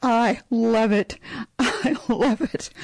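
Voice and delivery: high, delighted praise. Real, not acted. Delighted reaction to haiku.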